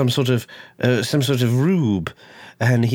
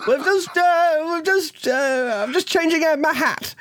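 much higher put-on voice, hesitant and halting; switched character voice. Player swapping characters, in a much higher put-on voice. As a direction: pop the voice right up into a new character.

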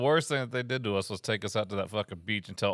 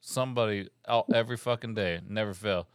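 usual speaking voice

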